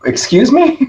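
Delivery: high, incredulous excuse me; taken aback. Retold or imitated. Speaker retelling his shocked reply to the coach, mock surprise.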